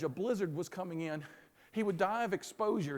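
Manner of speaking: usual speaking voice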